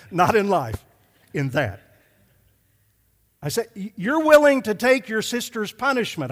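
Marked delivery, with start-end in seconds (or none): 3.8-6.3 raised pitch, loud, pointed restating; loud, high, insistent father driving a point; heavy emphasis. Retold or imitated. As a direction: hammer the key words home. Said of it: "I was trying to emphasize this".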